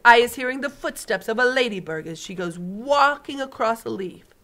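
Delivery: big friendly giant showing off his hearing; booming giant. Acted. BFG voice again.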